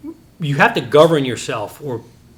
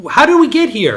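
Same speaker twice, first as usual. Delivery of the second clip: much higher and louder, thrown at the crowd; rhetorical outcry; 12 semitones higher, 9 dB louder than usual. Real, not acted. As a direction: call it out high and loud. Preacher's rhetorical question.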